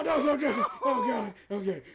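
usual speaking voice

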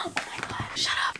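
real awed whisper; 1 stunned whisper. Real, not acted. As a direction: gasp then whispered disbelief. Opening a coveted gift.

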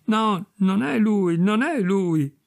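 a mildly hammed, drawn-out exclaimed denial; exclaiming. A narrator's reading. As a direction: cry it out, pleading and slow. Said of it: "Esclamò".

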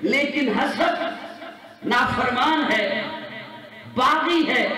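high, forceful sermon crescendo; full-force preaching. Real, not acted. Preacher building to the Prophet's question, impassioned high preaching.

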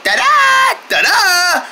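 high, bright fanfare cry; excited ta-da. Acted. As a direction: announce it like a showman. Show intro fanfare.

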